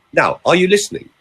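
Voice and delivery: usual speaking voice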